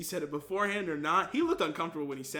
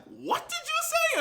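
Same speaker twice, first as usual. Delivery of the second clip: high, loud, drawn-out outraged demand; hammed-up indignant challenge; acted confrontation; 6 dB louder, slower than usual. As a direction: demand it in mock outrage, voice shooting up. Retold confrontation after cornering friend.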